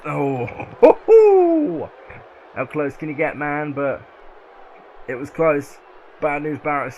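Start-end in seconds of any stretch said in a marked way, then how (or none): 0.0-1.9 high, loud oh ho ho whoop; shouted whoop. Real, not acted. Commentator whooping at the close count, loud high oh ho. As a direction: let out a soaring whoop. Reacting to close near-fall.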